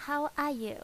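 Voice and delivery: "How are you?" high and bright, vowels softened; Vietnamese accent. Shown as a demonstration. Teacher demos the greeting in a Vietnamese accent. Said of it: "like we say".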